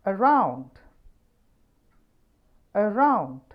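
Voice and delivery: Around with round loud; stress on round. Shown as a demonstration. Teacher drilling Around, stress on round. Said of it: "എ എന്നതിന് ശേഷം ഒരു stress ഉണ്ട്".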